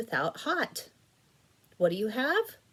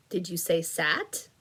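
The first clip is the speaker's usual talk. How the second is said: ordinary talk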